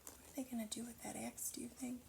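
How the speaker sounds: fast hushed whisper to the listeners. Real, not acted. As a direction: lean in and whisper the question. Reader asks children in aside.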